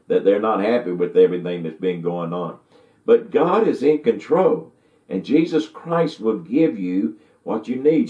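usual speaking voice

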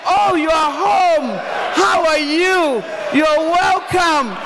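slow, loud, beaming welcome home; warm welcome. Shown as a demonstration. Wife greeting husband at the door, warmly welcoming. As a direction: greet him like he's the best news. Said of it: "learn to stop what I'm doing".

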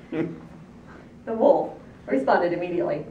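plain reading voice

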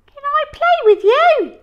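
very high, loud, bouncy question; eager dinosaur voice. Acted. Dinosaur asking the boys to play, high and loud. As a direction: ask like an excited big creature. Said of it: "he asked Hal and Billy".

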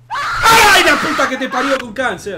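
high shriek into laughing curse; scream, then laugh and curse; panicked scream laughing. Real, not acted. Jump scare, laughs.

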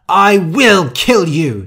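loud, high, drawn-out threat; screaming. A narrator's reading. Rosa threatening after Steve wakes, furious scream. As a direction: scream it in slow fury. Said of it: "screamed Rosa".

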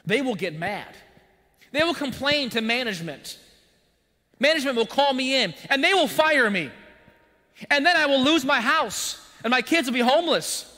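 mock panic building to a frantic pitch; panicked fear spiral.

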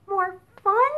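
high, loud eager question; excited question. Acted. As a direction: ask it with a leap of joy.

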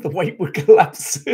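breaking into laughter while reading; laughing. Real, not acted. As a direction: crack up on the last words. Reads comment, cracks up at it.

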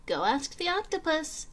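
lightly voiced starfish. A narrator's reading. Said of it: "said the starfish".

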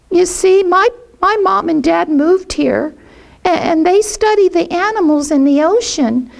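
put-on high, quick, stammering child voice; a small boy pleading to be friends; shy little boy. Jackie Paper telling Puff he has no friends, high shy child voice.

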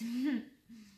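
short, light chuckle; a little laugh to close the greeting; brief friendly chuckle; small laugh. Real, not acted. Signing off before the story begins.

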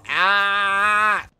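loud startled "ah"; startled scream. Real, not acted. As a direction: cry out loudly in fright. Startled scream during horror game.

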